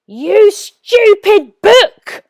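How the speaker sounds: high, slow, loud rage; furious shout. A narrator's reading. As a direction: scream at the useless book. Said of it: "he shouted".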